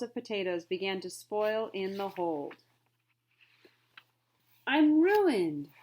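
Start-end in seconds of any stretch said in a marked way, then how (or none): 4.7-5.7 higher, louder wail of despair. Acted. Trader despairing over spoiled potatoes, loud higher wail. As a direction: wail it like all is lost. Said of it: "he wailed".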